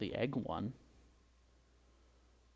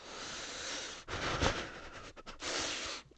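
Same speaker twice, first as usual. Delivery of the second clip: calming sigh; 5 dB quieter than usual. Acted. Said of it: "I'm getting too heated".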